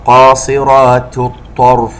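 قاصرات الطرف chanted slowly, light ت then emphatic ط; thin ta before heavy ta. Shown as a demonstration. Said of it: "ننتبه إلى ترقيق التاء".

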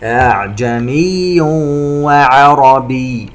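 slow chant, "أعجمي" hummed into "وعربي"; idgham with ghunna. Shown as a demonstration. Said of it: "تنوين وبعده حرف الواو". (ترتيل بإدغام "أعجمي وعربي" بغنة)

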